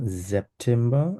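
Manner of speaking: সেপ্টেম্বর slowly as "zep-tem-ba". Shown as a demonstration. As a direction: correct it gently: সেপ্টেম্বর opening on Z. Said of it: "এস কে সেটের মতো উচ্চারণ করব". (সেপ্টেম্বর-কে ধীরে "জেপ্টেম্বা")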